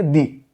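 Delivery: दी drawn out slowly as a long "dee"; dee not duh. Shown as a demonstration. Teacher drilling students on the long "dee" sound. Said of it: "ना कि दा".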